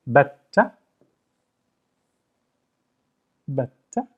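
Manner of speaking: "Batta" with a held stop on the double t; doubled t. Shown as a demonstration. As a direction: pause on the t of "Batta", then release it. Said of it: "So I know this is emphasized".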